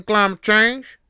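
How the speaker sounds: loud, high Southern holler; Southern accent. Acted. Hillbilly scoffing at climate change, shouted Southern accent. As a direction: yell it in a mock hillbilly drawl. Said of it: "I'm still gonna go with it".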